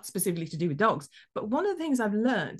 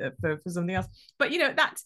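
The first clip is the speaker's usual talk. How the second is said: normal talking voice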